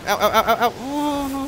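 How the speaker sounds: quick run of high ows; pained yelps. Real, not acted. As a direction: yelp with each bump. Bumping into things in game.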